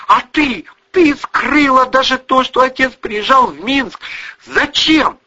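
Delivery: high, loud, indignant daughter; angry accusation. A narrator's reading. Daughter blames mother for broken family, furious. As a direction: hurl the blame, pitch way up.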